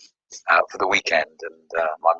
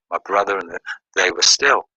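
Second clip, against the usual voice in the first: ordinary talk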